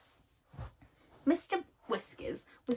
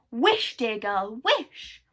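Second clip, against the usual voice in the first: slow, louder, rising plea; urgent excited shout; 7 dB louder, much slower than usual. Acted. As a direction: urge her to wish now. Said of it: "shouted Moonface".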